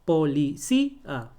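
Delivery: "Policía" slowly, stressed cí, then separate a; hiatus separated. Shown as a demonstration. Teacher showing where it separates, slow "Policía". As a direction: break "Policía" before the final a, leaning on cí. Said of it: "se separa aquí".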